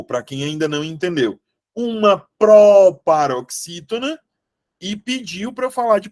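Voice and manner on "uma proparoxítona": "proparoxítona" with PRO long, high and loud; stress on PRO. Shown as a demonstration. Teacher exposing the exam board's mix-up, heavy stress on PRO. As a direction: punch and stretch the "pro" of "proparoxítona". Contrasts it with paroxítona.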